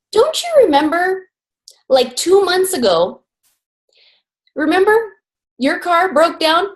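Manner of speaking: high-pitched, measured reminder; acted gentle reproach; chiding wife. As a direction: prod his memory piece by piece, with long pauses. Wife chides husband's short memory.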